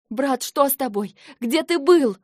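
gasping. Acted. Sister shocked by her brother's haggard look, gasping. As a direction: gasp it out at the sight of him. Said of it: "ахнула я".

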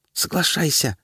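высокий настойчивый голос феи; подтолкнуть как фея в голове; pixie. A narrator's reading. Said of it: "раздался в голове голос феи". (голос феи в голове)

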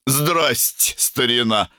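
put-on high, cheeky drunk hello; tipsy greeting. A narrator's reading. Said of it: "сильно навеселе". (пьяно и нагло)

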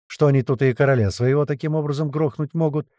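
usual speaking voice

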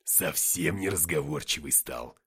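low, slow mock remark; deadpan low-voiced quip; dry joking. A narrator's reading. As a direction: joke darkly in a low, unhurried voice. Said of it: "пошутил Деймос".

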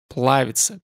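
plain reading voice

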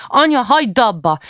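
high, urgent plea; child's shout. A narrator's reading. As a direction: cry out for a parent to stop. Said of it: "A nagy kiabált".

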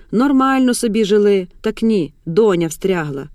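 usual speaking voice

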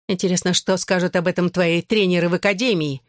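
ordinary delivery, nothing marked

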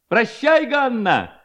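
high-pitched mock farewell call; crying out. A narrator's reading. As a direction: call out high, like a lad in the street. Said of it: "закричал в это время один из парубков". (высокий задорный выкрик)

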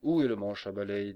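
loud, threatening demand; menacing. A narrator's reading. Faux threatening the stubborn bear in the cupboard, menacing. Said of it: "l'accent de la menace".

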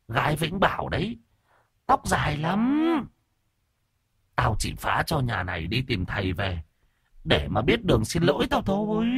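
high, thin spirit voice; ghost child voice. A narrator's reading. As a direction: speak as the small vengeful ghost. Ghost child keeps speaking.

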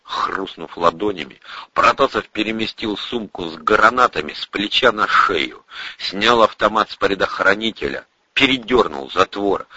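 ordinary delivery

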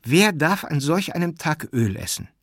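indignant question. A narrator's reading. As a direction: ask it as a scandalized rebuke. Aglaja rebukes him over oil. (empörte, vorwurfsvolle Frage)

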